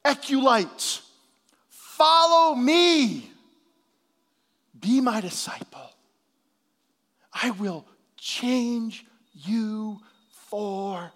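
loud, slow, drawn-out call; Jesus calling. Acted. Preacher voices Jesus calling the listener, slow solemn call. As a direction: call him like Jesus inviting a disciple. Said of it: "Jesus is saying".

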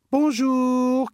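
loud high hello; calling out. Acted. Victor calling to a fisherman by the river, loud and high. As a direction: call out to someone far off. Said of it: "crie Victor à un pêcheur".

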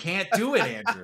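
squeaky scolding mother voice; high mock mom voice.

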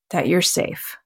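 ordinary delivery

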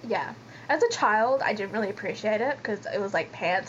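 ordinary talk, nothing marked

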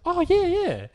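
retold old lady's high, eager yes. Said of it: "old multi-lady answers".

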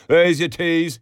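loud, high, brusque call; rude, banging delivery. A narrator's reading. Boots boy serving tea to barmaids, loud and rude. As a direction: call it out roughly over the counter. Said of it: "he banged on the counter".

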